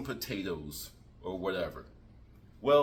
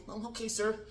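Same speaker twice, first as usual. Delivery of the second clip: nervous candidate; 7 semitones higher, 5 dB quieter than usual. Acted. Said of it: "You come in mad nervous".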